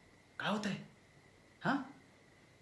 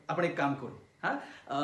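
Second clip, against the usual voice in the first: usual speaking voice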